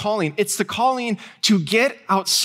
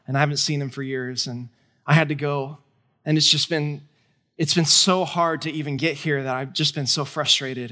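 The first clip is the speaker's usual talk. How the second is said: low, subdued retelling of a weary woman; somber; 6 semitones lower than usual. Retold or imitated. Woman confiding to seatmate about her dying son, somber. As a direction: quote her somberly, pitch dropped, understated. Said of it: "got a very somber look".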